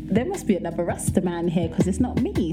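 ordinary delivery

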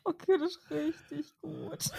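breathless after a laughing fit; slow, spent from laughing; after laughing hard. Real, not acted. Repeat while laughing.

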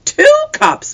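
very high, loud gasp of wonder; mother stunned at two prizes; astonished exclaim. A narrator's reading. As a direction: burst out in amazement. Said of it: "Mama exclaimed".

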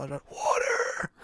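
slow, voiceless croak; drawn-out, hammed-up thirsty whisper; parched gasp. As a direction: act out dying of thirst. Acting out thirst in the heat.

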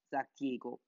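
plain reading voice

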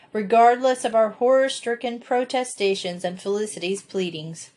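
ordinary delivery, nothing marked

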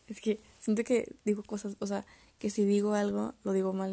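ordinary talk, nothing marked

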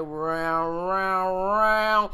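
slow dragging imitation of a music box; winding-down music box. Pastor mimicking a slow music box, slowed down. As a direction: stretch each round like the box running down. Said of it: "start playing real slow".

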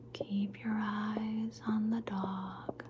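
very slow, drawn-out soft ASMR voice; slow hushed instruction. Acted. Guiding the listener's eyes to the dog, slow hush. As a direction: stretch each word out gently, lightly voiced.

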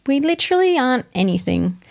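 ordinary talk, nothing marked

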